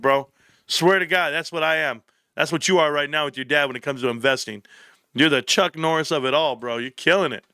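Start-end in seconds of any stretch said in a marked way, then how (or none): none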